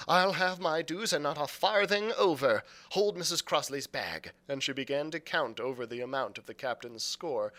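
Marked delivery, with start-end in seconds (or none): 0.1-4.3 louder, higher insistence on her dues; firm, defiant mother. Acted. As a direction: lay down the law, raised voice.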